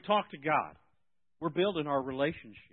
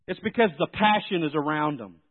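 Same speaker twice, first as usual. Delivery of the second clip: normal talking voice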